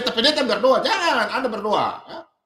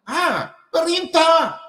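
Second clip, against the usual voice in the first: ordinary talk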